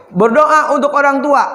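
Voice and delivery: ordinary talk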